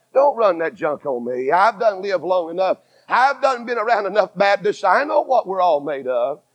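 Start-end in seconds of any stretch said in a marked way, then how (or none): none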